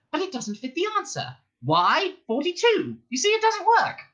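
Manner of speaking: high, quick interrupting mouse; Frankie mouse voice. A narrator's reading. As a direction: jump in impatiently. Frankie explains.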